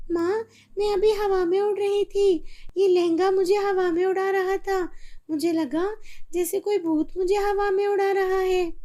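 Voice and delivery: put-on high, breathless child still frightened; girl telling her mother about the ghost, frightened; shaken girl. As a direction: tell mom what scared you.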